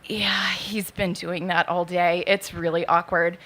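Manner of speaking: low, slow whisper; whispered aside. Retold or imitated. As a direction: whisper it across the check-in table. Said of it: "And she's like".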